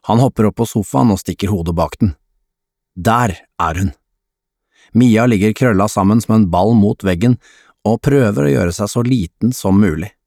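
plain reading voice

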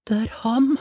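put-on high-pitched urgent boy's stage whisper. A narrator's reading. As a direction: play a small boy whispering up high. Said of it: "hvisket gutten". (lys, intens guttehvisking)

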